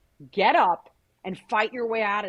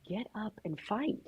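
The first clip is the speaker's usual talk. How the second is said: stern karate teacher; 2 semitones higher, 10 dB quieter than usual. Retold or imitated. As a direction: quietly order a pupil up, in retelling. Imitates stern karate teacher in story.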